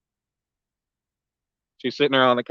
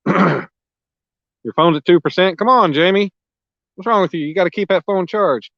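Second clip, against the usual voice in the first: teasing scold; 6 semitones higher, 3 dB louder than usual. Real, not acted. Teasing viewer Jamie about battery.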